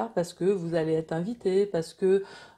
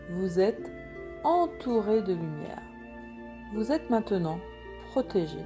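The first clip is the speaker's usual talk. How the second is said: guided meditation; 5 dB quieter than usual. Acted. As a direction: guide them gently into calm.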